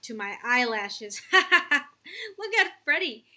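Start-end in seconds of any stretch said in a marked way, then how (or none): 1.3-3.2 high, laughing mock scream; screaming with laughter. A narrator's reading. Sister laughs at brother's face in cereal, laughing. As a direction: crack up at your brother. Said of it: "Susie screamed with laughter".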